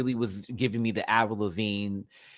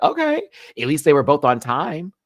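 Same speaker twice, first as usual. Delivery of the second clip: higher, louder, half-exasperated relief; loud relieved okay; 7 semitones higher, 9 dB louder than usual. Retold or imitated. Vlogger reacting to contestants finally on time, loud relief. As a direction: call it out like finally something went right. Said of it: "but I was like".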